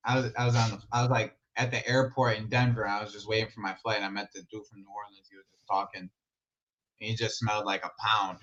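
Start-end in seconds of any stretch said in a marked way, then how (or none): none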